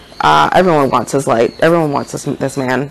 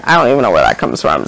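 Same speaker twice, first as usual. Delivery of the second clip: usual speaking voice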